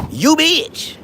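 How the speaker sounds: high loud yell; shouted insult. Real, not acted. Cursing at his critics, a high loud yell. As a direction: fling the insult with a sharp yell.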